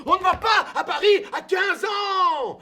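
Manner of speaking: cri aigu et fort de mère; mère qui crie. Retold or imitated. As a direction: refuse en criant, voix haute. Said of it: "dit ma mère". (la mère crie son refus, aigu et fort)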